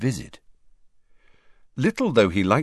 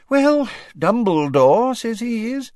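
high, fussy official voice; Fudge voice; 4 dB louder than usual. Acted. Fudge answering the Prime Minister in a high fussy voice. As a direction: play him as a flustered minister.

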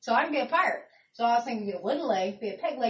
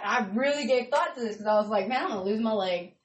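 ordinary delivery

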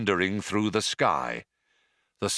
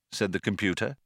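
plain reading voice

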